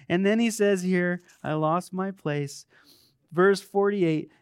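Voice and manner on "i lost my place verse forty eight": ordinary talk, nothing marked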